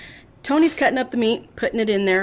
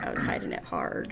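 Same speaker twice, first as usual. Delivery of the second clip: low aside; 10 dB quieter than usual. Real, not acted. Dropping low about pregnancy.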